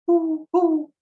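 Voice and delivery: very high loud hoo hoo; owl hoot. Shown as a demonstration. Teacher shows kids the owl, very high loud hoots. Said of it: "The hoot of the owl".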